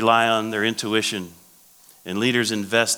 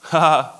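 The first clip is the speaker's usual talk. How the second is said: short, loud, acted "ha"; one high mocking laugh; scoffing laugh; 6 semitones higher, 6 dB louder than usual. Moses laughing at God's promise, a scoffing "ha". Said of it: "Moses is laughing at God".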